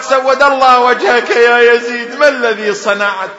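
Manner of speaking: loud cry of reproach; the woman crying out at the caliph in anger; angry outcry. Retold or imitated. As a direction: shout the curse at Yazid. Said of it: "ثم صاحت".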